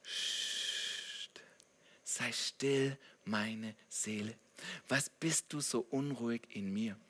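soft, low hush into murmured question; David hushing his soul. Acted. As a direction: shush yourself, then speak low and slow. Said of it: "Er sagt an einer Stelle".

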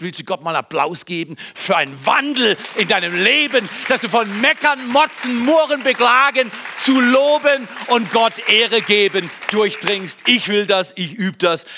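hoch gesteigerte, kraftvolle Predigerstimme; anfeuerndes Predigen. Real, not acted. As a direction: die Gemeinde zum Jubel antreiben. Ruft Gemeinde zum Applaus auf. (anfeuernd predigend)

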